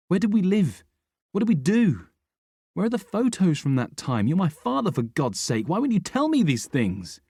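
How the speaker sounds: high, loud, pleading anger; angry demand. A narrator's reading. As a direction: demand it, voice cracking up. Son demands answers from Rick.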